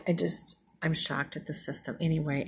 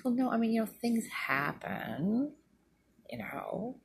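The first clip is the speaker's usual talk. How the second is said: evasive neighbour; 6 semitones higher than usual. Retold or imitated. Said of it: "the way she said it in her voice".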